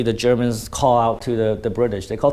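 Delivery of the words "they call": ordinary talk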